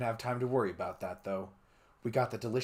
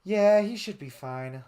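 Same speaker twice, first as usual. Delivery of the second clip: Takemi voice; 9 semitones higher, 7 dB louder than usual. Acted. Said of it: "she said".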